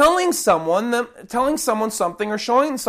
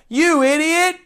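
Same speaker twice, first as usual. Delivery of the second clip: higher, louder scolding rant; mock angry rant; 5 semitones higher, 5 dB louder than usual. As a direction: ham up a furious scold. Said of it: "Jesus never once here says".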